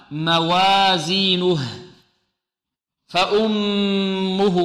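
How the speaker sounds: "موازينه" and "فأمه" drawn out very slowly; slow recitation. Shown as a demonstration. Teacher recites the verse words slowly. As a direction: stretch the doubled meem in "فأمه". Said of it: "لا تمال".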